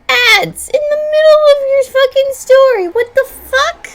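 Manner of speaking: squealing high and loud; high outraged shriek. Real, not acted. Vlogger shrieking about ads in the middle of a story.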